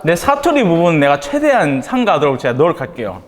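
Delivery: ordinary talk, nothing marked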